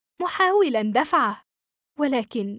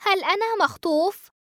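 anxious question; 8 semitones higher than usual. A narrator's reading. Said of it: "ثم تساءلت".

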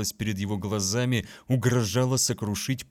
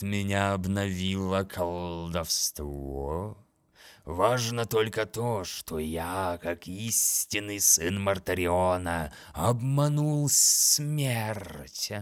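grating monster voice; slower than usual. Acted. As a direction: savour cheating death. Monster speaks.

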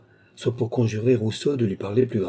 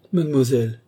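higher-pitched plea in one word; pleading; 6 semitones higher, 4 dB louder than usual. A narrator's reading. Gilbert begging Chon to stop talking, pleading. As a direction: implore her to stop. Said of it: "supplia Gilbert".

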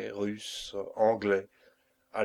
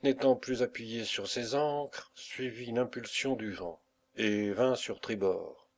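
usual speaking voice

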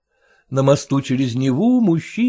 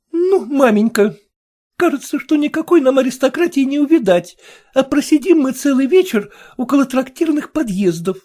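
complaining daughter; 12 semitones higher, 4 dB louder than usual. Acted. Daughter voiced, higher.

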